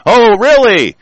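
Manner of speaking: high, scornful comeback; sarcastic disbelief. Real, not acted. Speaker answering the employment claim, sarcastic. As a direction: push the pitch up in mock surprise.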